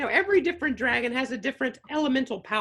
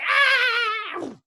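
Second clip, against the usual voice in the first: retold kid's yelp; 9 semitones higher, 4 dB louder than usual. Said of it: "she's just like".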